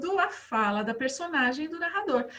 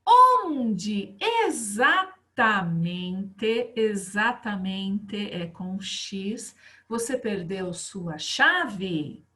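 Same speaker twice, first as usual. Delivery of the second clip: slow dictation; much slower than usual. Shown as a demonstration. Teacher dictating the text slowly.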